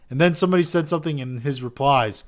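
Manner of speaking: ordinary talk, nothing marked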